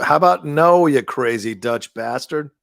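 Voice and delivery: loud, drawn-out mock villain taunt, slowing on the second half; Doctor Evil impression. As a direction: sneer it like a cartoon supervillain. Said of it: "Doctor Evil's".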